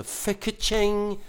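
high, drawn-out comic sound effect; imitated bursting noise. Storyteller showing credit cards flying everywhere, high stretched sound effect. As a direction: voice a card case springing open.

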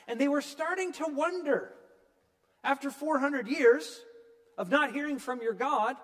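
pleading questions. Pretend. Voicing the people's anguished questions.

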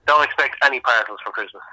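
ordinary talk

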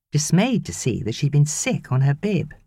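usual speaking voice

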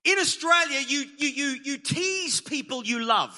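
usual speaking voice

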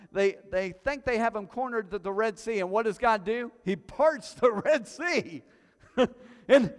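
high, loud and driving sermon climb; preaching at full force. Acted. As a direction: build it like a revival preacher.

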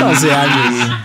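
slow disbelieving laugh; laughing in disbelief. Real, not acted. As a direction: laugh out the words in shock. (riéndose incrédulo)

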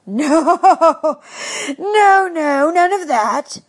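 high, laughing no, no. Acted. Rabbi laughing off Pickle's idea of a circus, high and amused. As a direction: chuckle through the refusal. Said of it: "laughed the rabbi".

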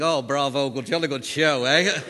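hearty upper-class English cheer; loud posh English cheer; posh English accent. Shown as a demonstration. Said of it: "do the old English thing".

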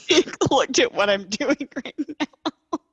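slow, loud words through laughter; laughing. Real, not acted. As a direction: laugh while you say it. Laughing while telling.

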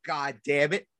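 normal talking voice

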